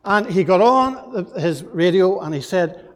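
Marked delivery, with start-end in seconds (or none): none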